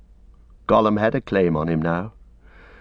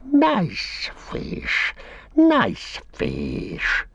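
Gollum voice; 9 semitones higher, much slower than usual. A narrator's reading. Reader as Gollum, gloating over fish in a creature voice. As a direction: croon it like a starved, greedy creature.

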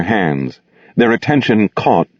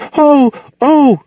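high, louder terrified wail; 4 dB louder than usual. Acted. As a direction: wail it out in terror. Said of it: "she wailed".

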